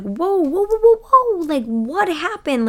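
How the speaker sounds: hammed-up viewer disbelief; shocked whoa. Fans reacting to the contestant's turn, mock shock. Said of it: "we were all like".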